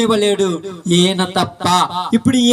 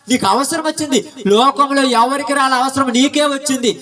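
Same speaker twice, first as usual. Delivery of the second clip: ordinary talk, nothing marked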